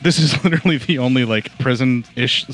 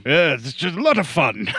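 mock British accent; 3 semitones higher than usual. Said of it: "our terrible British accents".

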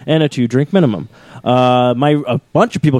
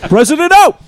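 mock presidential sign-off; 12 semitones higher, 3 dB louder than usual. Closing the impression.

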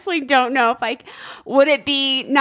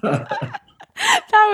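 laughing praise. Real, not acted. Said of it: "It's terrible".

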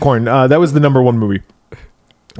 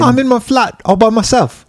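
high, hammed-up British kid accent; a cheeky British kid alone in his flat; mock British accent, pitched up; 10 semitones higher, 3 dB louder than usual. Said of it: "It's a British one".

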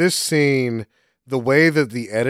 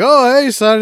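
dad voice; 10 semitones higher, 6 dB louder than usual. Retold or imitated. Dead dad greets son.

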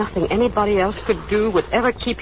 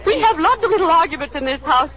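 higher, bitter forced cheer through sobs; laughing through tears; 8 semitones higher than usual. Acted. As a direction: keep smiling while the voice cracks with crying. Alice breaks down before guest.

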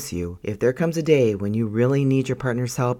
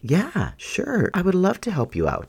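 bright, much higher, keen to help; eager and willing; 9 semitones higher than usual. Shown as a demonstration. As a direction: offer help with a big willing smile. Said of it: "you can say something like".

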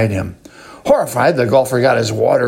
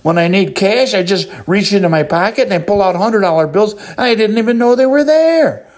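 delighted golfer; 8 semitones higher than usual. A narrator's reading. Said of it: "the golfer says".

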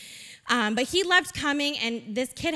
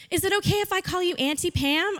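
put-on higher, little-boy question; sweet child's request; 6 semitones higher than usual. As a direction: ask shyly, lightly voicing a child. Voices the boy's sweet request.

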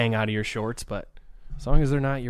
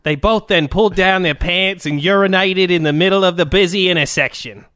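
mock Australian accent; 4 semitones higher, 4 dB louder than usual. Reading an Australian fan's letter, a strained mock accent. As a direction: push through a shaky Australian. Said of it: "trying so hard to not be as shitty".

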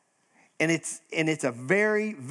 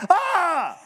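mock startled shriek, much higher and louder; startled scream; 13 semitones higher, 6 dB louder than usual. People see an angel, mock startled shriek. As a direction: act out a fright at the sight. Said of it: "and everyone's like".

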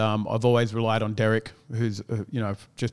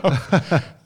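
a loud real laugh; burst of laughter; 5 semitones higher, 6 dB louder than usual. Real, not acted. Laughing with the co-host at his own story.

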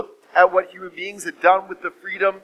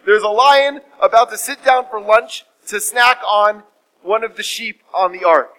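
usual speaking voice

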